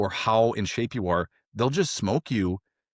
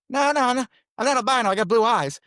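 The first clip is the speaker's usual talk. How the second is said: high, loud pleading denial; frantic mock protest; 6 dB louder than usual. Trainee doing flutter kicks answers instructors, frantic mock protest. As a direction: protest in a strained high yell, hammed up.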